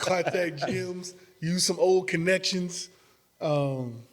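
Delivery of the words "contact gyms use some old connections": "Contact gyms" repeated much higher, slow and laughing; light up at the idea; perked up. Real, not acted. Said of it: "See J D perk up when he said that?".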